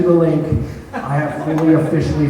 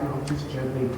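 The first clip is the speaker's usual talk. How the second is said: ordinary talk, nothing marked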